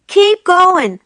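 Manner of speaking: gruff farmer's urging shout. A narrator's reading. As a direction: bark it at the other drivers. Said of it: "the fat Boggis shouted".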